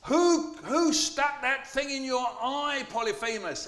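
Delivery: very high, loud, slow outcry; the other giants asking Polyphemus who hurt him; shouting Cyclopses. A narrator's reading. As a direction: call out in alarm to your friend. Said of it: "all the Cyclopses around Polyphemus shouted".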